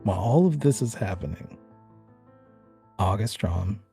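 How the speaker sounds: ordinary delivery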